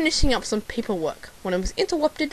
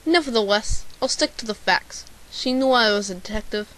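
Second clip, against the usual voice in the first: ordinary talk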